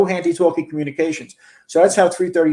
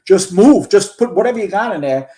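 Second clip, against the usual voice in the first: high-pitched urgent command, bursting louder on "move"; retold yelling; 6 semitones higher, 4 dB louder than usual. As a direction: re-enact an exasperated fireground yell. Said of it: "I'm just yelling".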